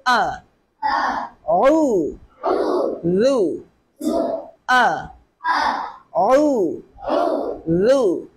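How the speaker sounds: أ, عو, ذو spoken one by one with pauses; split into syllables. Shown as a demonstration. Teacher splits the word for the pupil. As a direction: break أعوذ into separate slow syllables. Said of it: "syllable breakdown".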